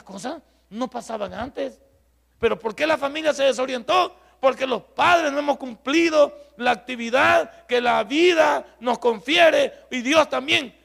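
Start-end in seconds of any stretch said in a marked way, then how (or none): 2.4-10.7 high, loud, slow sermon shout; preaching at full force. Real, not acted. Preacher scolding parents for failing as guides, full-force shout. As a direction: thunder the rebuke at the parents.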